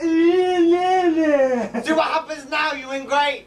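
slow, sung-out taunt; taunting singsong. Acted. Cosby kid taunts ingrate, slow singsong taunt. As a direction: draw it out like a nasty tease.

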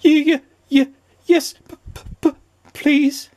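very high, broken stutter; quivering stammer. A narrator's reading. Mister Jelly asking for the secret, quivering stammer. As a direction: stutter each first sound in squeaky fear. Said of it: "quivered and shook and said".

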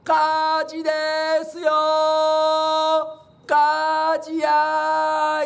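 stretched panic cry of fire. Retold or imitated. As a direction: bellow it out to wake the whole town. Calling fire around the neighborhood.

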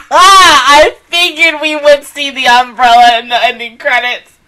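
high, loud shrieking laugh through the words; cracking up at the show; laughing hard. Real, not acted. Reacting to the anime ending, laughing loudly. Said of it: "Oh, I'm crying".

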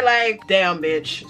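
ordinary talk

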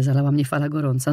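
ordinary delivery, nothing marked

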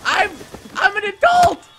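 high, loud mock protest; yelled protest. Player protesting during the game, high indignant yell.

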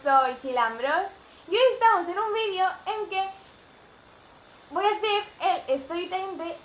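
loud, high, drawn-out hello; big, slow, sing-out greeting; vlogger intro. Real, not acted. Vlogger greeting viewers at the video's start, loud and excited.

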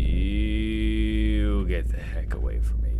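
low, soft, long stretched YOU. Real, not acted. Same warning, drawn out.

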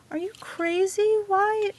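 high delighted squeal; excited squeal. Real, not acted. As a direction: squeal it, overjoyed. Gift reaction, squealing.

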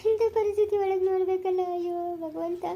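slow, drawn-out sobbing wail; weeping, words dragged out between sobs; tearful lament. Acted. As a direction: plead with God through sobs. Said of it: "ಅಳ್ತಾ ಇದೀಯ ನೀನ್ ತಿರ್ಗಾ?".

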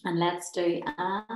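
usual speaking voice

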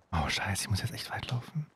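very soft, low worried whisper. Real, not acted. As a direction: fret to yourself under your breath. Tense game, worried to himself.

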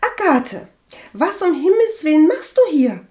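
high, loud startled cry; horrified surprise. A narrator's reading. Cousin startled by Agathe's sudden visit, horrified. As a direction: exclaim in alarm, pitch shooting up. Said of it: "Walburga wich entsetzt zurück".

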